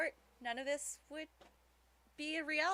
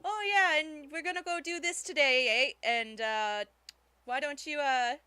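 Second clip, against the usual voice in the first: louder, imitated New England cousins; cousins' New England accent; 2 semitones higher, 8 dB louder than usual. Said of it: "they talk like this".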